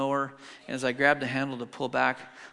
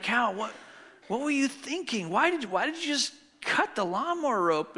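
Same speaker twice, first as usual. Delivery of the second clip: higher, pitched-up disbelieving scolding; exasperated dad; 7 semitones higher than usual. Retold or imitated. Dad scolding his son over the cut lawnmower rope, incredulous and higher. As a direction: demand an answer from a guilty kid. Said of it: "I'm like".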